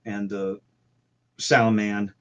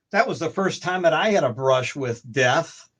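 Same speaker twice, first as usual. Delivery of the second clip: ordinary talk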